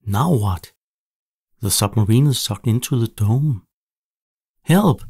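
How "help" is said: high, loud, fast cry for help; shouting. A narrator's reading. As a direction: yell it out, losing control of the sub. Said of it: "Tom shouts".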